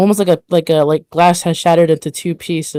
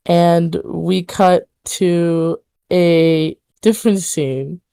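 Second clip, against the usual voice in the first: ordinary delivery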